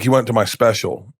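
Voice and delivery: ordinary talk, nothing marked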